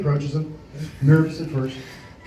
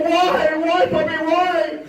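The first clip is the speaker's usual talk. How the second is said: higher, anguished pleading; [name] holding his puppy, hammed-up grief; grieving cry; 10 semitones higher, much slower than usual. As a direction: wail over the lost puppy.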